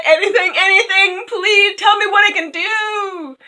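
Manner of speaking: high, loud cracked begging; desperate pleading. Acted. The king begging the statue, desperate and tearful. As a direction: beg as if on the edge of tears. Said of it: "the king said".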